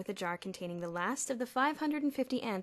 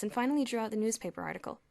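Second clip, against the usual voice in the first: ordinary delivery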